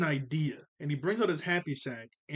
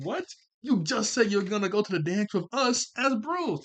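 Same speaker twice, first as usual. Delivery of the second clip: mock disbelief; 6 semitones higher, 4 dB louder than usual. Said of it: "he wasn't, like, yelling".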